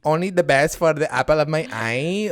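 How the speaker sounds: botched, hammed-up Spanish accent; Hermosa. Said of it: "I'm doing this accent so wrong".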